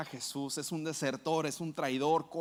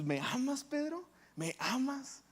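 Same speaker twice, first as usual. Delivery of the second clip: tender question; 7 semitones higher, 4 dB quieter than usual. Acted. Jesus asks Pedro tenderly.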